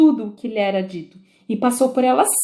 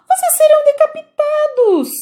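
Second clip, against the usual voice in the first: alarmed cry; 6 dB louder than usual. Acted. Alice warns gardeners.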